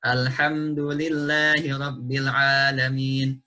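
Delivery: slow chanted recitation of "alhamdulillahi rabbil alamin", lillahi held two beats; recited Quranic Arabic. Shown as a demonstration. Teacher reciting the verse slowly to students, correct tajwid. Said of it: "Misalnya pada alhamdulillahi".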